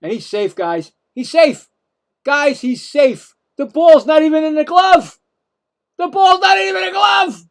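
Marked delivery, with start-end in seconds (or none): 1.1-3.3 louder, higher insistence that he's safe; outraged protest. Real, not acted. Protesting umpire's call live. 3.6-5.2 higher, louder indignant call-out; indignant yell. Real, not acted. Protesting umpire's call live. 6.0-7.4 much higher, louder shout stressing not; full outraged shout. Real, not acted. Protesting umpire's call live.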